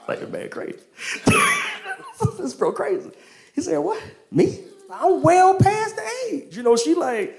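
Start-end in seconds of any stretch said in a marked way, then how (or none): 0.1-3.1 high-pitched giggle of disbelief; mock chuckling. Sarah overhearing God's promise at the tent, mock incredulous chuckling. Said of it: "And she started chuckling". 3.8-6.5 high, drawn-out squeal of disbelief; mock incredulity. Sarah told she will bear a son, high mock disbelief.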